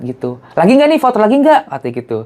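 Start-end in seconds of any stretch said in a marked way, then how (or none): 0.6-1.7 put-on much higher, louder Betawi-accented offer. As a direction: ask in a lively Betawi lilt. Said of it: "dengan logat Betawinya dia".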